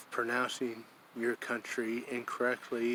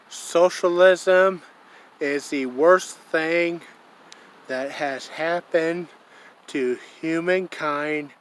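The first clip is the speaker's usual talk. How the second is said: loud, slow, higher quoted line; emphatic quote; 3 semitones higher, 9 dB louder, slower than usual. Retold or imitated. Quoting the young man from Venezuela, slow and loud. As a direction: declare it slowly and loudly. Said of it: "this is a direct quote".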